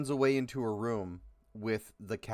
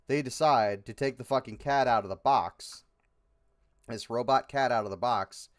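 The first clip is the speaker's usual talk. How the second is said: ordinary talk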